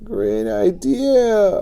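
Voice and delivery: high, very slow squeak. Acted. Boy hanging from tree squeaking reply. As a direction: squeak it out weakly. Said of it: "I squeaked".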